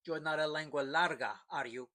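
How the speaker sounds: mildly nasty, suspicious question; still nasty. A narrator's reading. Pancho suspecting the captain will inform, mildly nasty. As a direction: probe him with light contempt. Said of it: "he asked, still nasty".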